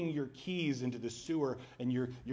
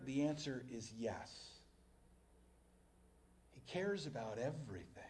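quiet reassurance; 9 dB quieter than usual. Real, not acted. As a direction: close gently with assurance. Closing reassurance to congregation.

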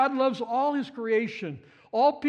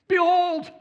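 Behold, higher and much louder, a burst of wonder; excited exclaim; 6 semitones higher, 6 dB louder than usual. Retold or imitated. Preacher acting John's thrilled cry, louder and higher. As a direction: shout the discovery. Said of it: "He's all excited about this".

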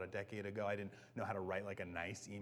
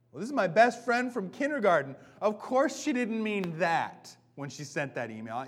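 higher, louder, bright dismissal of worry; cheerful benefit of the doubt; 7 semitones higher, 10 dB louder than usual. Pretend. Reader defending best friend's email, higher and louder. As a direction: brush it off cheerfully, of course she meant well.

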